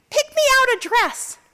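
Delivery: put-on high demanding kid voice; mother voicing her little daughter, high and demanding; little girl voice. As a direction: ask like a small child.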